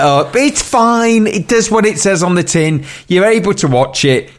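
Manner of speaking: high-pitched retold reassurance; mock easygoing defence. Podcaster retelling his own shrugging defence of the film, high-pitched. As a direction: pitch it up, waving the doubts away. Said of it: "I was a bit like".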